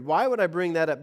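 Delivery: normal talking voice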